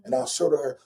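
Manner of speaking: ordinary talk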